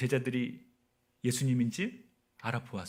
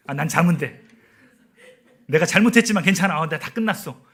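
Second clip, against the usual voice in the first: mock carefree relief; 3 semitones higher, 10 dB louder than usual. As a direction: proclaim relief in an exaggerated inner voice. Acts out imagined relief after punishment.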